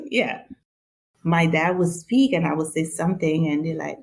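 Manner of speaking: ordinary talk, nothing marked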